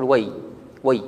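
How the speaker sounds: "وي" cut off alone as way, before any k; stop after way. Shown as a demonstration. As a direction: halt right after the y of "وي". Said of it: "فمنهم من يقف يقول".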